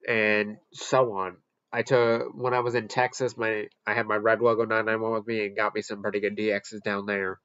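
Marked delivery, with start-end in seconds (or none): none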